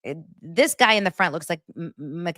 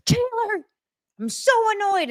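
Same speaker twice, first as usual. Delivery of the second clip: high, whiny grumble at [name]; mock annoyed Travis. Travis grumbling to [name] about being annoyed. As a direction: pout it out like a sulky boyfriend.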